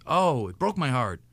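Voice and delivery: ordinary delivery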